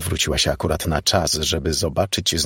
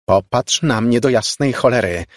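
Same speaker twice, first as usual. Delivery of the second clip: louder, higher, exasperated command; angry shout; 5 semitones higher, 5 dB louder than usual. A narrator's reading. Margo wrestles with the girl's averted face, angry shouting. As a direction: repeat the order in fury. Furious repeat of shouted order.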